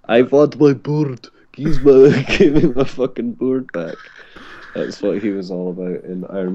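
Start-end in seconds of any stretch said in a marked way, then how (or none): 0.0-4.0 hammed-up thick Russian accent, a bit higher; requested movie-villain impression, cracking into laughter; Mickey Rourke impression. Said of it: "So yeah, I could do that".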